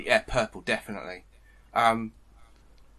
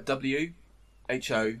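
spelling it out; 7 dB quieter than usual. Shown as a demonstration. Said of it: "How, how do you spell it?".

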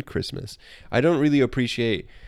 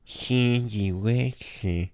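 slow voice out the side of the mouth; mock a comic voice, dragged out slowly; slow, side-of-mouth character impression; Sandy Wexler; much slower than usual. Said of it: "Speak out the side of his voice".

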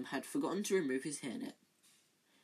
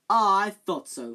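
much louder, higher know-it-all cry; eager Lockhart; 5 semitones higher, 13 dB louder than usual. Acted. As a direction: jump in to look clever. Lockhart butting in.

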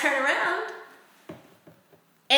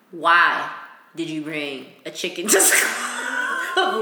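trying to stay serious while cracking up; holding back laughter; 6 semitones lower, slower than usual. Retold or imitated. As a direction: keep a straight face but laugh. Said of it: "I'm like".

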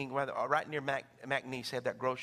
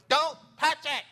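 stern grandma; 10 semitones higher, 7 dB louder, slower than usual. Retold or imitated. Grandma warning child.

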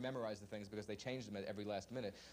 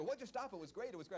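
mock surprise; 9 semitones higher than usual. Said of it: "and I go".

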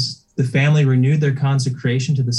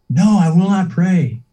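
higher, louder, slow defiant refusal; stubborn refusal; 6 semitones higher, 4 dB louder than usual. Acted. Dying man refusing the priest, a defiant higher louder no.

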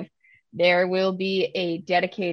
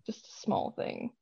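coy whisper, playing it down; 13 dB quieter than usual. Real, not acted. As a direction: whisper it like a secret. Downplaying big news, whispered.

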